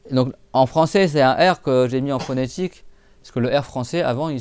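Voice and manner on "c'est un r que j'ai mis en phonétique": usual speaking voice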